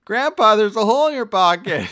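put-on high, loud child role; mock grandson. Grandson telling grandpa about his pocket, high loud mock-kid voice. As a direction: cry it out like a kid in a parody.

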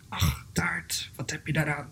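soft whispered dismissal; whispered self-talk. Acted. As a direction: whisper it off as if indifferent. Bear muttering to itself aside.

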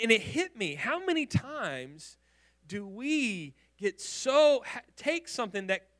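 usual speaking voice

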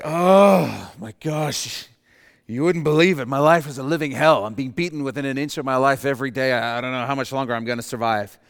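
a desperate captive pouring out misery; suffering hostage. Pretend. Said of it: "You say".

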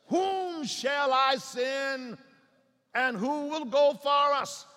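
loud, drawn-out scripture proclamation; preaching declamation. Acted. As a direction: ring out each word, high and slow.

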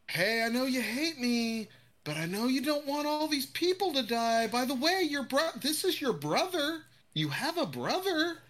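high put-on sneering dad voice; mock him with a silly voice; mocking Omni-Man. Podcaster mocking Omni-Man's excuse, high sneer. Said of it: "It's more like".

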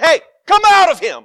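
high, loud command; commanding shout. Acted. Jesus ordering the spirit out, high and loud. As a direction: cast the spirit out with force.

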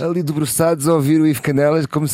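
high, loud mocking tone. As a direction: poke fun at smug men. Mocking content men at theatre. (tom trocista)

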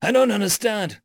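high, flustered confusion; agitated, baffled high voice; bewildered. A narrator's reading. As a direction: blurt it out in high-pitched dismay. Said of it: "stammered Biograt".

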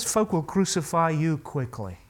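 usual speaking voice

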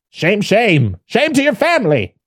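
high, hammed-up scolding; scolding elder. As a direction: wag a finger and scold.

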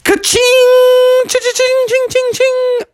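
very high ringing ka-ching noises; loud, high clinking imitation; imitated jackpot jingle. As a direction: voice a falsetto chime of winning. Imitates jugs crashing down.

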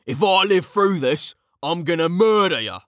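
high-pitched character voice, threatening; angry threat. Acted. Officer threatening his ally while fighting the monster, angry.

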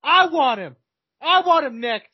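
high, slow, shouted mic challenge; post-fight callout. Pretend. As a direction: holler it like a fighter demanding the champ. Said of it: "Could you imagine Tommy or Jake right afterwards?".

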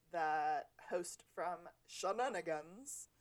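a bad put-on accent, slow, on Shenanigans; deliberately bad accent on Shenanigans; terrible accent. Shown as a demonstration. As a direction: drag out Shenanigans in a clumsy fake accent. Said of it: "That was a terrible accent".

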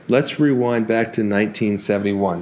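ordinary talk, nothing marked

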